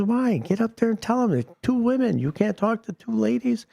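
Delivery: high-pitched disbelief at a friend's reluctance; incredulous prodding. Acted. Golfer scoffs at timid friend.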